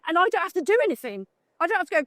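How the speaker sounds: normal talking voice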